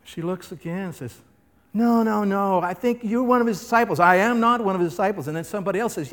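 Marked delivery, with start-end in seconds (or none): 1.8-4.0 high, fast, pressing; girl insisting Peter is a disciple; insistent servant girl. Acted. 4.0-5.3 loud, fast, stressing "not"; firm denial. Acted. Peter's second denial.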